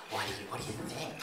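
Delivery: hushed, quick imitation of the bride asking; a confiding little question, mock bride; 1 quoting Connie softly. Best man mimics the bride asking his opinion, hushed. Connie voiced in wedding speech.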